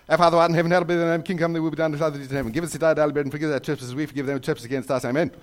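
fast, droning recital racing to Amen; rattled-off prayer. Retold or imitated. Boy at the back of church racing through penance, rattled off. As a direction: gabble the prayer at top speed. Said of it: "reciting those as fast as I could".

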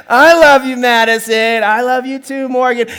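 loud, hammed-up affection; mock-gushing sibling exchange; overdone child voices. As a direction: belt it out like a kid complying. Children giving exaggerated forced affection.

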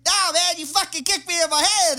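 a high, loud, indignant retort; angry accusation. Retold or imitated. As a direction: confronts him for the kick to the head. The kicked guy's angry reply.

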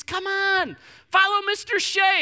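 put-on high, bright coaxing call to kids; coaxing counselor. Counselor calling the wary kids to follow, coaxing. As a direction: coax the little kids along.

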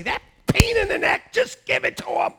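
high-pitched mock exasperation; exasperated God. Preacher imagines God giving in to a nagging believer, comically exasperated. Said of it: "Sometimes I want him to say".

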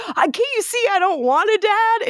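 high-pitched, overdone protest; hammed-up rejection of a father; mock defiant son. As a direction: imitate a son protesting too much.

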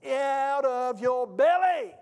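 slow, drawn-out preacher's shout; booming punchline. Acted. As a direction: belt it out word by slow word. Preacher voices the Lord's punchline.

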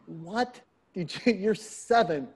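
acted disbelief, pitch climbing; astonished dad. Dad replying to his seven-year-old, stunned. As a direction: stammer it out, taken aback, a laugh breaking in.

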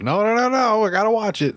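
high, pleading mock of the co-host; whiny mock protest. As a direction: imitate a stubborn fan begging, up high. Voicing co-host's protest.

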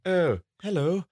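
high, put-on lady voice; lightly voiced high police lady; Misty. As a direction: introduce yourself as a prim comic lady. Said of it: "I have to do all the voices".

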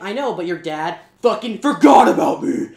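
a hurt imp complaining the dad forgot him, loud and angry. Retold or imitated. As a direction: snap it out with a bitter grudge, loud. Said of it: "He's all angry".

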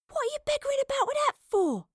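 fast, very high exasperated scolding; irritated hiss. A narrator's reading. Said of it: "she hissed".

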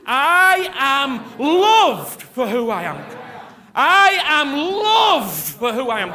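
slow, loud, ringing proclamation; bold declaration. Shown as a demonstration. Preacher shouting declaration for the standing congregation. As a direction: shout it out with joy. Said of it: "get it in your spirit".

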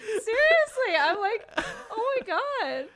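choked up and laughing at once; real happy tears breaking in; laughing through tears. Real, not acted. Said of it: "I'm literally crying".

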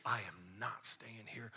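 hushed, insistent whisper-shout; quiet yelling. Retold or imitated. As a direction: yell it in a hard whisper. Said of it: "You ever yell quietly?".

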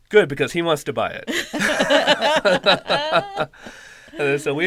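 laughing while quoting; loud and laughing through it. Retold or imitated. Jess quoted, teller laughing.

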